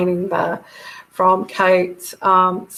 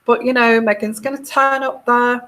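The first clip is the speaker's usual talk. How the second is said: ordinary talk, nothing marked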